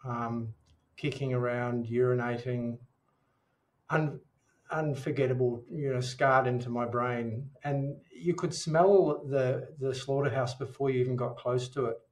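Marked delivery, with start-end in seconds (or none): none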